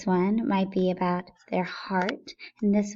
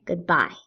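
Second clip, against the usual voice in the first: goodbye with a light good and a stronger bye; stress on bye; 3 semitones lower than usual. Shown as a demonstration. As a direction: lean on the second syllable, bye, in goodbye. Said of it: "we stress bye".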